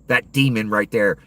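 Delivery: normal talking voice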